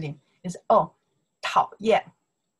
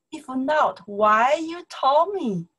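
indignant question; 6 semitones higher, 3 dB louder, slower than usual. Pretend. Voiced student's protest.

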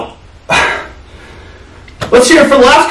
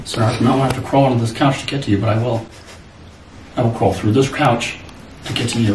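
quiet threat; 13 semitones lower, 9 dB quieter, faster than usual. Acted. Threatening to crawl under couch.

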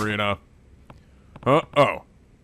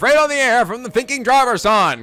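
hammed-up radio host; 7 semitones higher, 4 dB louder than usual. Reading the host's excited lines.

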